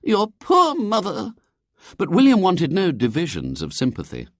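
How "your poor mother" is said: very high, slow lady's voice; pitying lady. A narrator's reading. Lady hears father drinks, pitying and high. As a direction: dwell on the pity.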